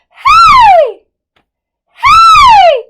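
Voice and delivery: very high, piercingly loud hey; head-voice scream. Shown as a demonstration. Teacher demonstrates using the head for high notes, head-voice scream. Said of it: "we're going to scream".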